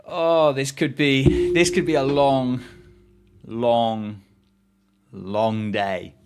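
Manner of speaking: slow, drawn-out dread; weary foreboding. Real, not acted. Streamer foreseeing a bad day, slow drawn-out dread. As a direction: stretch out each long.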